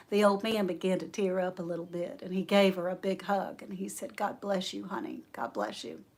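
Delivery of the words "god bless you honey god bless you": low, very soft thanks; tender blessing. Retold or imitated. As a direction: imitate a moved old man. Old man tearful, blessing her.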